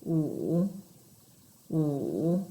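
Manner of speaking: "Wu", each dipping very low then rising; third tone, dipping. Shown as a demonstration. Teacher trying number five in third tone, low dip then up. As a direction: sink "Wu" to the bottom of the voice, then lift it. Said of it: "very, very low".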